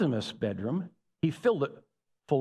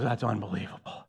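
deadpan disbelief; 7 semitones lower, 6 dB quieter than usual. Acted. Dad reacts to son's meltdown.

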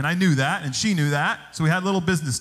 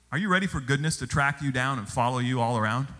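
ordinary talk

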